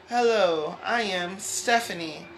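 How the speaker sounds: carefully enunciated Standard American English, loud and slow. Shown as a demonstration. Speaker showing how she talks in class English, exaggerated Standard American. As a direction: announce yourself in formal Standard American English. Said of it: "when I speak American Standard English".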